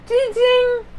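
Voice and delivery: high "ding ding" of a bell; door bell. Acted. As the door opens on the shop, imitated bell. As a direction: chime like a little doorbell.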